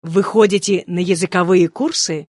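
ordinary delivery

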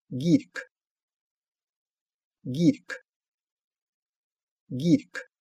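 "Гирьк" with a voiced initial g; teacher's careful isolated "Гирьк", g kept voiced; demonstrated pronunciation. Shown as a demonstration. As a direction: model "Гирьк", clear g at the start, released k at the end. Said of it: "всегда произносится «г»".

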